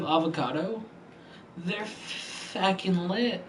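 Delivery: slow put-on voice. Drawn-out odd voice.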